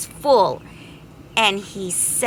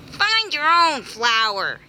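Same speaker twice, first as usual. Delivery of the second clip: greedy bee shouting; 7 semitones higher than usual. Acted. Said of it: "he shouted".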